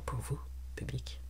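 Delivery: dans un souffle, sans voix; chuchoté. Real, not acted. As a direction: glisser ça tout bas. A whispered address to viewers. (murmure complice au public)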